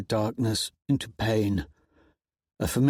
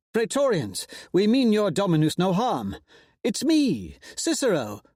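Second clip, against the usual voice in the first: much higher, lilting noble voice; Cicero; 9 semitones higher than usual. A narrator's reading. Cicero to the Praetorians, placating. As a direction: soothe the armed guards with flair.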